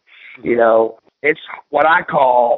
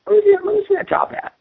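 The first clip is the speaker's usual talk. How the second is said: high, eager mock delight; light up at the hat you just spotted; excited discovery; faster than usual. To the hat store clerk, much higher eager delight.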